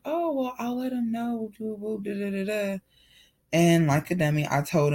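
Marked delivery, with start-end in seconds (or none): 0.0-2.8 high, airy mock-cheerful voice, much higher; sing-song fake innocence, pitched way up; fake sweet brush-off. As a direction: play it dumb and breezy, hiding the truth. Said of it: "And I was like".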